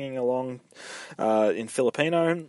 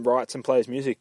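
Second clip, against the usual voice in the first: ordinary talk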